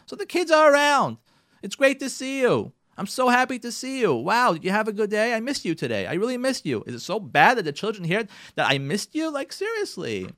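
getting carried away making the case; worked up. Real, not acted. Speaker urging spouses to speak lovingly, worked up. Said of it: "I'm getting worked up here".

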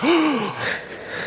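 high, sharp mock gasp. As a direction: gasp like spooked. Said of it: "she'll be like".

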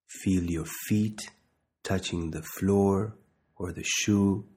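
slow, measured meditation guide with long pauses; unhurried body-scan instruction, leaving space to breathe; guided meditation. Real, not acted.

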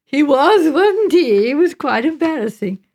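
lively, amused lilt. A narrator's reading. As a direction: agree with gleeful amusement. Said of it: "Joanna laughed".

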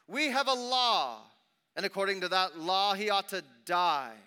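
loud, high, insistent retort; forceful accusation. A narrator's reading. Accusers citing their law to Pilate, loud insistence. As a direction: declare it hard at the governor.

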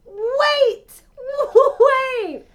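high, loud disbelieving cry; real stunned exclamation; mouth-agape shock. Real, not acted. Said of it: "My mouth is agape".

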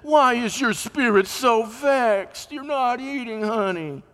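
high, slow, syrupy wife's voice; Jezebel's coaxing voice. Acted. Jezebel coaxing her sulking husband, high and slow. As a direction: coo it like a doting wife. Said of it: "came out to him and said".

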